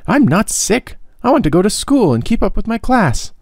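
put-on high, earnest boyish plea; pleading boy. A narrator's reading. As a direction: beg to be let back to class. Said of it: "he broke in".

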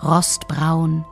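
plain reading voice

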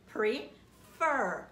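"prefer" very slow, with a loud, heavy "fer"; stress on last syllable. Shown as a demonstration. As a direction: split "prefer" and punch the final "fer". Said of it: "we stress that last syllable".

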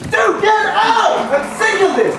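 very high, loud, slow yell; angry shout. Acted. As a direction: scream at him to get out. Host throws interviewer out.

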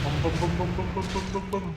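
quick run of mouth-made booms; playful explosion sound effects; imitated explosions. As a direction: boom along with the blasts, fast. Voicing the skill's explosions.

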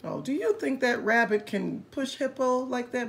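plain reading voice

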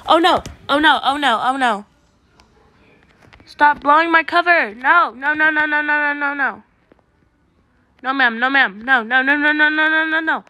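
panicked pleading. Real, not acted. Hider pleading as the seeker closes in, panicked and loud. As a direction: beg it over and over in a panic. Being hunted in game.

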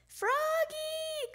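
put-on father summoning his child; calling out. A narrator's reading. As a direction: holler the name as if across the house. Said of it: "called his father".

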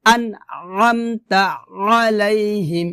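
slow আমতা আলাইহিম with squeezed throat; measured pharyngeal আলাইহিম; pressed ain recitation. Shown as a demonstration. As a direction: press both আ sounds from mid-throat. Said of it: "কষ্ট করে উচ্চারণ করতে হবে".

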